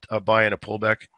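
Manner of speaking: usual speaking voice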